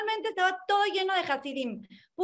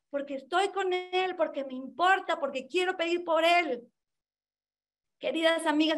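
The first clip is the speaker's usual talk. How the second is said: usual speaking voice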